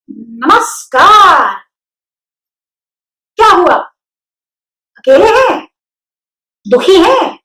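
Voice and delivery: high, small spider voice; thin little voice. A narrator's reading. Spider greeting the lonely pig in a thin voice. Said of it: "महीन सी आवाज ने उसको बोला".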